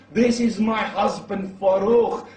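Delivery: loud, high, drawn-out cry; retold shout. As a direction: shout it as the wife announcing her husband. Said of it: "She shouts".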